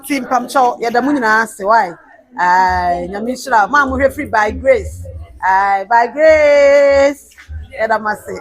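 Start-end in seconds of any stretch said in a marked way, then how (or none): 5.4-7.3 drawn-out praise. Real, not acted. Host drawing out thanks.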